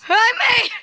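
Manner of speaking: high, drawn-out cry of victory; triumphant shout. Real, not acted. Player announces finally making the climb, triumphant high shout. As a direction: stretch it out jubilantly. Celebrates reaching the top.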